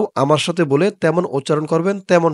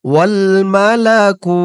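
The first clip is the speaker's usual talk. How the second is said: slow recitation; 3 semitones higher, slower than usual. Shown as a demonstration. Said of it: "আপনারা আমার সাথে বলবেন".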